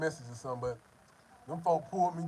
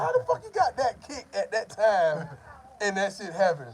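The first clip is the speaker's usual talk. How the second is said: disbelief; 5 semitones higher, 5 dB louder than usual. Retold or imitated. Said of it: "I'm like".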